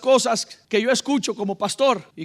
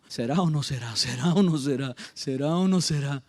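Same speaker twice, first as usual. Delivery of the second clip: low, wavering-in-doubt mutter to oneself; slow hesitant pondering; 7 semitones lower than usual. Acted. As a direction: play Samuel doubting, in a low repeated murmur. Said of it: "Fue como con más lento". (titubeo pensativo en voz grave)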